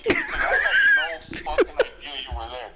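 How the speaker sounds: high, loud, laughing outburst; laughing shout. Real, not acted. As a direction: yell it through real laughter. Cracking up at the reveal.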